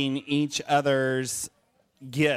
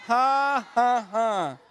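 spoken "ha ha ha", higher; hammed-up fake laugh; mock laugh; 5 semitones higher, much slower than usual. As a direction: cue the kids to laugh with a fake chuckle. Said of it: "You're supposed to laugh".

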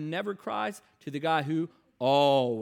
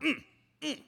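imitating stifled hum; 10 semitones higher than usual. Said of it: "you do this mm thing".

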